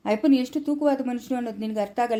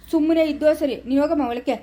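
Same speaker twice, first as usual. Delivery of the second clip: loud, higher-pitched order; angry silencing; 5 semitones higher, 4 dB louder than usual. Acted. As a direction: cut her off with raised voice. Husband silences wife in quarrel.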